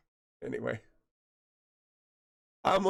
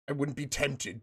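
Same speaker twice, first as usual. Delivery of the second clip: normal talking voice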